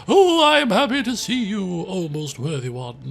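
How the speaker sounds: grand, theatrical greeting swelling then settling; hammed-up delight at a visitor; effusive storyteller character. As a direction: play a gushing, self-important acquaintance. Voices the character Shanier greeting.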